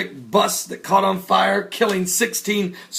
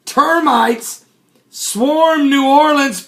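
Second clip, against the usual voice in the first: normal talking voice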